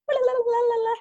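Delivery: high-pitched mock babbling; playful burst of nervous la-la-la; nervous energy. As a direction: imitate jittery chatter in a very high voice. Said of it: "'cause you're nervous, you have all that energy".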